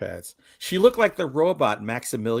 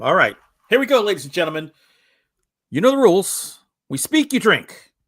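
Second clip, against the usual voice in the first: loud hyped announcer voice; 6 semitones higher, 6 dB louder than usual. Pretend. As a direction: hype up the crowd. Announcing drinking game rules.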